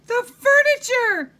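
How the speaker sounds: high, loud, drawn-out wail of dismay; shrieking storybook outrage; horrified cry. A narrator's reading. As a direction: cry out aghast, high and slow. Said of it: "she cried".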